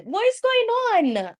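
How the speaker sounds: high, slow incredulous question; high-pitched what is going on; disbelief. Real, not acted. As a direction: plead in disbelief. Disbelief at players falling.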